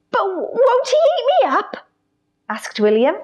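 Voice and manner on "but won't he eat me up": put-on scared child voice. Scared child William asks.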